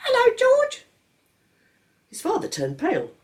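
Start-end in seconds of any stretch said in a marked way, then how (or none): 0.1-0.8 put-on very high tiny baby voice; baby echoing his father's hello. A narrator's reading. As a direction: squeak it back like a baby. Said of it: "the baby replied".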